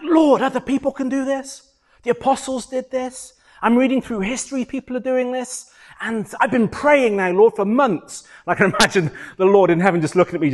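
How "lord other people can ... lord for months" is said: high, frustrated complaint to God; pleading in exasperation, pitched well up; frustrated plea. Retold or imitated. Preacher retelling his months of unanswered prayer to the Lord, frustrated. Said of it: "I was so frustrated".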